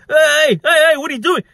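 high, loud, exaggerated calling out; hammed-up high "hey" of protest; mocking mimicry. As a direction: mimic someone's alarmed yell to ridicule them. Man mimics her calling out.

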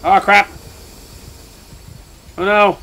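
loud panicked cry, pitch jumping up; real scared yell. Real, not acted. Player panicking at the monster, loud frightened yell. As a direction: yell it as the monster grabs you. Monster attacks in horror game.

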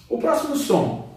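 ordinary talk, nothing marked

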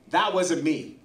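normal talking voice

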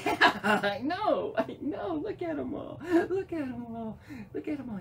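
high, excited cooing; pet talk. Real, not acted. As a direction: gush to a little pet. Talks to squirrel about the kids.

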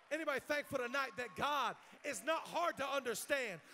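loud, high, shouted preacher's crescendo; full-force preaching. Real, not acted. Preacher rallying a church to give thanks, shouted preaching. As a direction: drive each line home to the congregation at full volume.

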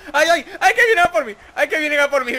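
high, loud panicked cries; being chased. Real, not acted. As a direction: yelp as they rush you. Enemies charging at him.

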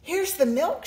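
high eager shout. A narrator's reading. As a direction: call out the milk proudly. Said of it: "shouted Jack".

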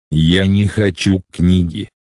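ordinary talk, nothing marked

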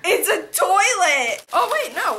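high, loud, drawn-out squeals; thrilled amazement. Real, not acted. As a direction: squeal with laughing delight. Squealing over each new gift.

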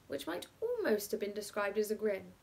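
usual speaking voice

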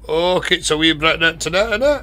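high, loud mock-Scottish babble; old Scottish lady. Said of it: "as old and as Scottish as".

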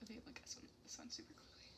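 hushed whisper. Real, not acted. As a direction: whisper it to the partner. Whispering aside to partner.

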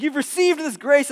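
high, loud indignant accusation; acted outrage. Servants confronting the forgiven man, high indignant protest. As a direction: protest with pitch and volume up. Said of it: "and they're outraged".

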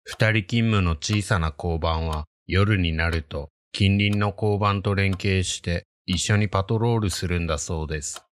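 ordinary delivery

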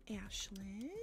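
soft whispered name; whispered thanks to Ashlyn. Real, not acted. Shout-out to the raider.

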